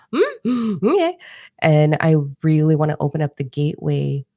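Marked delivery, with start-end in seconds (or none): 0.1-1.2 high, rising mock-unbothered mm okay; shrugging it off. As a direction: brush off the punches with a sing-song okay. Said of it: "and I'm like".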